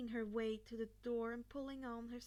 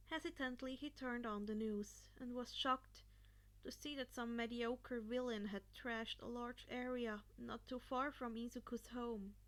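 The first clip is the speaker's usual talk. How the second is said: plain reading voice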